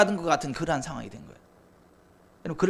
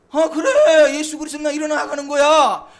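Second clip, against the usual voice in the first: loud, high proclamation of faith; bold declaration; 6 semitones higher, 9 dB louder than usual. Retold or imitated. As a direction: shout it out like a triumphant vow. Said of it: "선포한 거예요".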